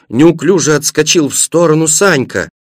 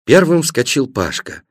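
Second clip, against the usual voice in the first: ordinary delivery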